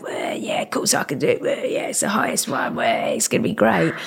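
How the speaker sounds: mock arrogant self-talk. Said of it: "arrogant, confident".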